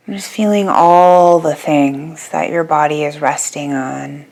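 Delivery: unhurried yoga nidra guidance with long pauses; guided meditation. Real, not acted. Yoga teacher guiding listeners to settle their bodies, calm guided meditation. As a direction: ease them into rest, phrase by phrase, leaving long silences.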